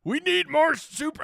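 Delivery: high comic cartoon voice, chuckling; a squeaky cartoon read; put-on voice. Host retrying the super chat in a cartoon voice. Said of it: "I gotta do the voice".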